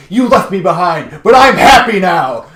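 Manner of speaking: loud, high cry upward; yelling at the sky. Retold or imitated. Clark yelling at the night sky to his lost father, loud and high. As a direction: shout it up to the stars. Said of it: "He's just yelling up at the stars".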